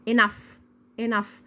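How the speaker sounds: "enough" with a final f sound. Shown as a demonstration. Teacher reviews the rule for learners, "enough" ending in f. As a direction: repeat "enough", gh said as f. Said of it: "زي صوت حرف الاف بالظبط".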